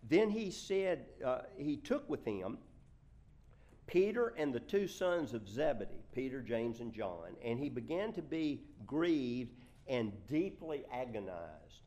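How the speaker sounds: loud, emphatic Bible reading; forceful reading aloud with weighted words; scripture reading. Acted. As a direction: project the verse to the congregation. Reads scripture aloud to congregation.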